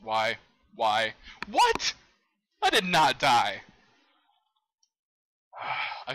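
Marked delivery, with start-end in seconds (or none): 1.5-1.9 very high, loud squeal of disbelief; outraged yelp; shocked disbelief. Real, not acted. Disbelief at dying in game. 2.6-3.6 loud, slow, raised-pitch denial; real exasperated protest; indignant protest. Real, not acted. Protests a death in game.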